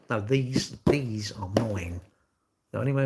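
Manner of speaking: possessive whisper, slow. Acted. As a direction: hiss it like a secret hoard, slowly. Guarding his chocolates.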